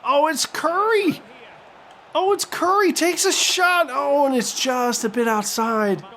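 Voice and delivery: high-pitched rush on the shot; excited play-by-play then a letdown; excited commentary. Real, not acted. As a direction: call the shot with rising hope.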